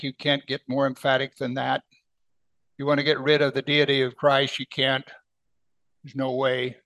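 ordinary delivery

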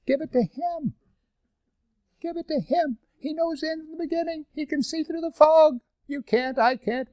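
very high, softened plea; gentle urging. Real, not acted. Preacher urging listeners to let God steer, gentle plea. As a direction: plead softly in a high, light voice.

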